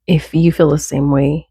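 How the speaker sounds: normal talking voice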